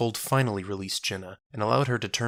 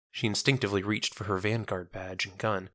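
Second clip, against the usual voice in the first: ordinary delivery, nothing marked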